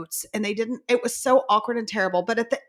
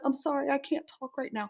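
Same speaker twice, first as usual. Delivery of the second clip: imitating choked up; 3 semitones higher, 10 dB quieter than usual. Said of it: "she got so choked up".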